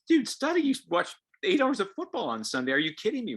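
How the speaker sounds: loud, high exasperated rant; exasperated parent. Retold or imitated. Parent ranting at a kid who skipped studying, loud and exasperated. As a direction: vent your disbelief at the lazy kid.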